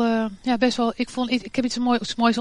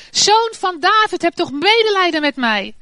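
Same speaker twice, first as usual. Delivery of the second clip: loud, much higher desperate cry; shouting even louder; 6 semitones higher, 5 dB louder than usual. A narrator's reading. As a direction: yell it over the crowd. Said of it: "schreeuwde nog harder". (nog harder schreeuwend)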